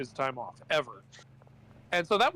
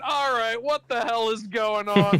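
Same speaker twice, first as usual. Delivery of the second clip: mock outrage; 6 semitones higher, 5 dB louder than usual. CEO outraged at manager.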